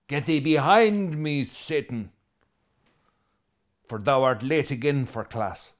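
mock scolding. Teacher scolding a late student, hammed-up and loud. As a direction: play it as a jokey classroom scolding. Joking modern teacher version.

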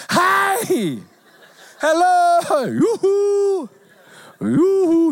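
high, loud hailing cry with a laugh; calling out. Retold or imitated. Preacher acting Jesus calling at the door, high and loud. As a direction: call Yoo-hoo across to a house. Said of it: "It's like".

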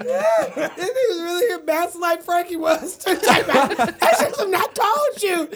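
high, slow, laughing through words; can barely get it out for laughing; high-pitched laughing fit; laughing hard. Real, not acted.

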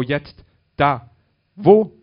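escalating excited cries; calling out. A narrator's reading. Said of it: "rief Bob".

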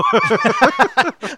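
loud high burst of laughter; big laugh. Real, not acted. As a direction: crack up out loud. Reacts to joke.

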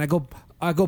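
ordinary delivery

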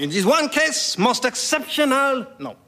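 high, careful French excuse; French accent. Acted. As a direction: back out in pompous French. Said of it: "in outrageous French accent".

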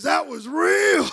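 high, drawn-out yell; amazed shout. Retold or imitated. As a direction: shout it slowly in hammed-up astonishment. Son amazed after falling down.

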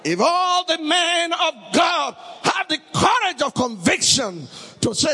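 high, ringing preacher's cry; preaching shout. Real, not acted. Preacher rousing the congregation, shouting. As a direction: thunder it at the congregation.

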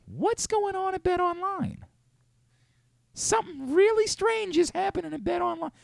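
very high-pitched, thin put-on voice; Brian Windhorst. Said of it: "I feel like Brian Windhorst right now".

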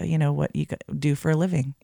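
ordinary talk, nothing marked

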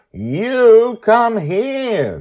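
put-on very slow, high, loud child voice; overly slow. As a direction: exaggerate every word through glass. Joy repeating slowly.

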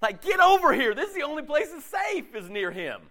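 eager welcome, pitched high; calling a friend in for a hug; welcoming call. Retold or imitated. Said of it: "You just go".

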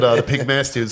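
usual speaking voice

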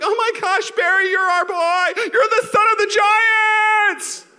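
high, loud gushing shout; hammed-up fan hysteria, building to a stretched cry; adoring fan. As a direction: scream it like a besotted home crowd. Voices adoring home crowd.

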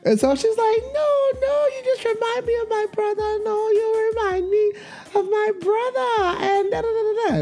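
high, whiny mock voices; mocking coworkers. Women in the meeting fussing over Mike in high mock voices. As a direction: squeal it like gossiping coworkers.

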